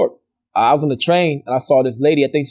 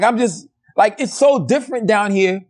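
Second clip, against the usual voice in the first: ordinary talk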